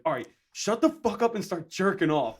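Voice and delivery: loud, high freaked-out yell; retold scream. Freaking out at the Skype caller, retold scream. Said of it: "I just screamed it out".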